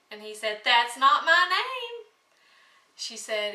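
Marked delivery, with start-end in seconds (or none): 0.6-2.1 higher, louder gloating little man; little man's taunt. Acted. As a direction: crow it back at the queen, pleased with himself. Little man refusing each guess.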